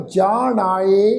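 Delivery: ਜਾਣ ਆਏ said very slowly, split into two words; ਜਾਣ ਆਏ drawn out as a careful model reading; correct reading. Shown as a demonstration. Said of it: "ਤਾਂ ਪਾਠ ਉਚਾਰਨ ਕੀ ਹੋਏਗਾ?".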